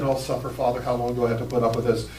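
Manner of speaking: low, soft, fast exasperated sigh; a tired teacher rolling his eyes; weary sarcasm. Retold or imitated. Jesus sighs over disciples, low and exasperated. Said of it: "I could just hear it in his voice".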